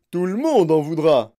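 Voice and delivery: plus fort, indigné. Acted. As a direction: crier son indignation. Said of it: "cria monsieur Gloop avec indignation". (M. Gloop crie, indigné et plus fort)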